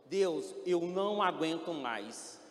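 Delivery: loud, slow, desperate plea; desperate cry to God. Retold or imitated. Patient crying out to God in despair, loud and slow. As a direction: cry out that you can't go on. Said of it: "E eu falei assim".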